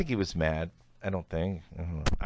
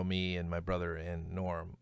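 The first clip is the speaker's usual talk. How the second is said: ordinary talk, nothing marked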